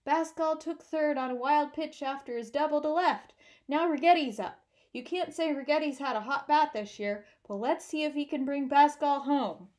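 loud, nasal play-by-play voice; radio announcer. Acted. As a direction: build suspense like a radio announcer. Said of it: "Huey overheard Leo's voice".

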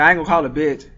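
ordinary talk, nothing marked

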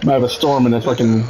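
usual speaking voice

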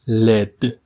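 لد with a short e, like led; lead said led. Shown as a demonstration. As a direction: say لد to rhyme with bed. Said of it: "دقت کنید لید تلفظ نکنید". (لد با صدای اِ کوتاه)

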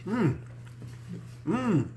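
chewing while humming; muffled closed-mouth hum; mouth full. Real, not acted. Seen 1: bites fried piece at -3, closed-mouth chewing during hums.

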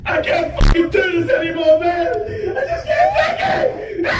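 high, shouted mock breakdown; hammed-up despair. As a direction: scream it like you're at breaking point. Hammed-up despair before jumping off.

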